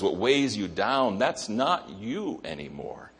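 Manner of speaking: ordinary delivery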